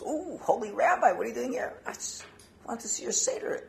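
high, hushed awe at an honored visitor; surprised householder. Acted. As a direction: gasp a reverent welcome, voice lifted and softening. Startled householder greets visiting rabbi.

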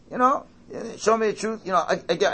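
ordinary talk

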